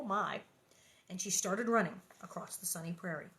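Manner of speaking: usual speaking voice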